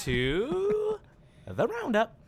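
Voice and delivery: announcer voice. Acted. Introducing the segment with fanfare.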